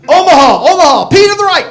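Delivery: loud, high shouted play call; quarterback audible. Pretend. Quarterback calling the play at the line, loud high shout. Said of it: "like Peyton Manning calling an audible".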